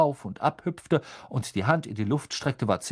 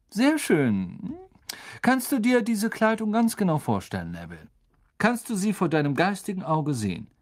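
kind teacher; 8 semitones higher than usual. A narrator's reading. Teacher explaining the spell to the class, higher and friendly. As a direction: explain patiently like a gentle teacher. Lupin voiced.

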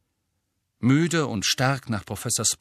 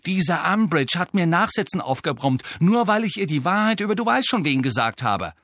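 higher and louder, defensive; indignant protest; 7 semitones higher, 5 dB louder than usual. A narrator's reading. Harry defending himself to Angelina, indignant and loud. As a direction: push back at the unfairness.

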